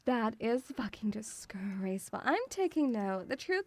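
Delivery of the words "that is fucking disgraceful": gasped, whispered outrage; gasp, then hiss it under breath; scandalised gasp then whisper. Pretend. Reacting to chat's theft, mock-scandalised whisper.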